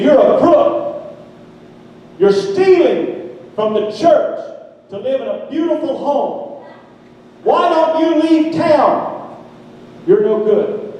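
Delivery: high, drawn-out denunciation; accusing letter read aloud. Acted. Preacher reads the hostile letter to congregation, accusing. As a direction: declaim each accusation slowly and high, pausing between.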